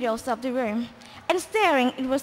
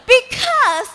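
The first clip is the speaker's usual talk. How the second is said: higher, louder princess shriek; 9 semitones higher, 9 dB louder than usual. Acted. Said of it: "shrieked the princess".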